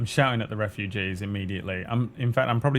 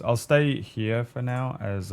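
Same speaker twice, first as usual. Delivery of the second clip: ordinary talk, nothing marked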